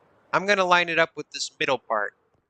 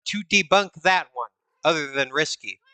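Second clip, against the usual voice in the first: ordinary delivery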